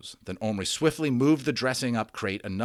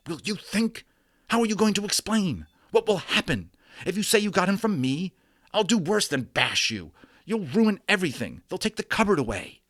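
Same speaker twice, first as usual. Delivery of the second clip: much higher, louder desperate pleading; frantic boy; 11 semitones higher, 5 dB louder than usual. A narrator's reading. Omri pleads with Patrick not to tell, frantic. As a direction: shake him and beg him to think.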